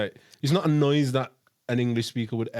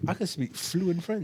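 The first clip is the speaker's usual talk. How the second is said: mock boast; 4 semitones higher, 5 dB quieter, slower than usual. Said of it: "I cannot, folks".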